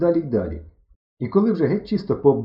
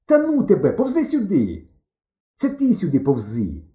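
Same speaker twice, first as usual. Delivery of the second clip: high, loud, exasperated shout; hollered squabble; 5 semitones higher, 4 dB louder than usual. A narrator's reading. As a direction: argue back by shouting. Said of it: "перегукуючись". (роздратоване перегукування)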